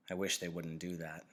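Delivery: ordinary delivery, nothing marked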